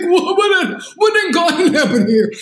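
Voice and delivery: high, laughing mock shock; stunned disbelief. Acted shock at the pregnancy.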